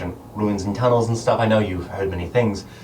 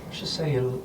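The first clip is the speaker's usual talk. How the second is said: whisper; 5 semitones higher, 7 dB quieter than usual. Acted. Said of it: "Celine whispers to you once more".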